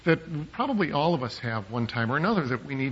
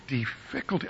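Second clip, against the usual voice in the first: "difficulty" stressed on its second syllable, di-FIC-ulty; misplaced stress; 2 semitones lower, 8 dB quieter than usual. Retold or imitated. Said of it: "the emphasis on the wrong syllable".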